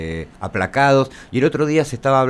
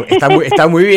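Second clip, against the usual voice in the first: higher, louder, laughing through it; laughing reassurance; 8 semitones higher, 10 dB louder than usual. Real, not acted. Host reassures the guest about her accent, laughing loudly.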